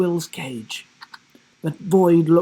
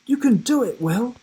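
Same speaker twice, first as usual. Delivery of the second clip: encouraging; 10 semitones higher than usual. A narrator's reading. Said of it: "she encouraged him".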